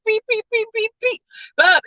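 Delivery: high beeping device noise; quick high electronic beep imitation; imitated beeping. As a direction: chirp the beeps up high like a gadget.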